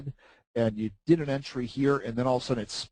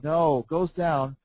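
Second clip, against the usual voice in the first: normal talking voice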